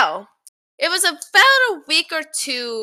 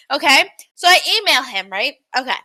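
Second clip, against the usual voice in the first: usual speaking voice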